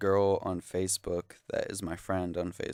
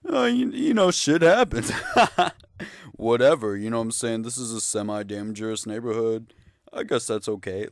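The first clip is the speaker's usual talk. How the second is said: mock cop voice; 3 semitones higher, 8 dB louder than usual. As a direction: play the cop as a careless cartoon. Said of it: "the cops are like".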